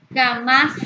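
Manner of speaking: "Jamás" loud, with -más punched; ja-MÁS, loud and rising; stress on last syllable. Shown as a demonstration. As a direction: hit the final syllable of "Jamás" hard. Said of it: "con mayor intensidad".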